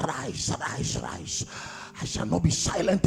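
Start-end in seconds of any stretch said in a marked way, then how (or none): 0.1-1.5 low growled rise, rise, rise; much lower rasped growl; lion growl. Shown as a demonstration. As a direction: growl each word like a stirring lion. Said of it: "That lion-like spirit in me".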